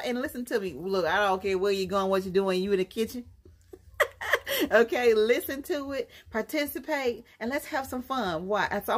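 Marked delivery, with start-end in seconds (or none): 4.0-4.7 short high laugh. Real, not acted. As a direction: laugh at her own joke. Laughs at kitchen joke.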